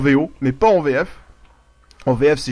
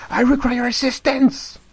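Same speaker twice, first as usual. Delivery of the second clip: a rough try at the Medic's line, a bit higher and slower, heavy French accent; bad Medic impression; 8 semitones higher, slower than usual. Shown as a demonstration. Streamer botches the Medic's voice line for his viewers, clumsy mock voice. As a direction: mangle the Medic's I require assistance in English. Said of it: "je sais très mal le faire".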